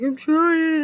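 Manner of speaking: protesting partner. Retold or imitated. Partner defends his efforts, mocked in high protesting voice.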